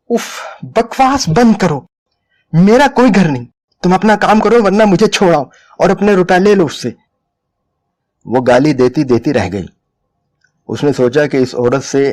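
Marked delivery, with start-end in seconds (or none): 0.1-7.0 furious outburst. A narrator's reading. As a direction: explode at him in rage. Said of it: "औरत और ज्यादा खशमनाक हो गई". (ग़ुस्से से भड़कती, ऊँची आवाज़)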